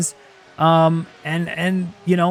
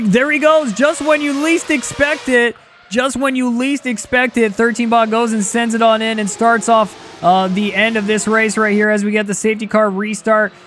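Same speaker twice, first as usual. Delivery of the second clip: higher-pitched live race commentary; excited play-by-play; 4 semitones higher than usual. Real, not acted. Commentator calls the safety car restart to viewers, excited play-by-play. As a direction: call the sudden restart with rising excitement.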